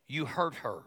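ordinary talk, nothing marked

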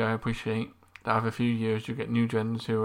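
ordinary delivery

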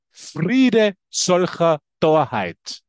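very slow, weighty pronouncement; slow solemn quote. Retold or imitated. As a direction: lay each word down slowly. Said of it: "der sagt". (sehr langsam und feierlich zitiert)

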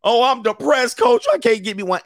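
high, whiny mock complaint; a lightly voiced whiny loser; mock whiny guy. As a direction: imitate a sad guy moaning to his coach. Mocking sad men complaining to coach.

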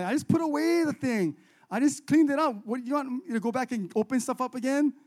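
high-pitched, put-upon complaint; acted whiny disbelief; exasperated. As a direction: protest the extra work. Imagined annoyed reply to late request.

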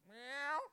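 loud, high imitated meow; cat meow. Preacher mimics Meow Meow waiting at the door, loud high meow.

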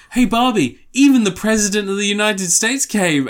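mock excited greeting. Woman at the party greeting Barbie, mock excited. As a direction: hype up Barbie with a bright call. Woman's quote in film retelling.

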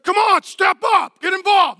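high, loud urging shout; hammed-up pushy shouting; lion spouse. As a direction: yell at a passive partner to act. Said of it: "You're like".

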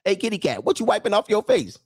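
playful acted interrogation; suspicious cat owner. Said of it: "You like".

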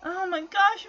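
high, small mock-forlorn voice; sad. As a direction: imitate a crushed, pitiful teenager. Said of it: "he just looks so sad, like".

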